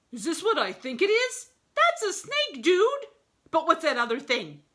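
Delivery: high, loud puzzled dog voice; confused dogs. A narrator's reading. Dogs spot a snake in the pound, high-pitched confusion. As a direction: question it in alarmed bafflement.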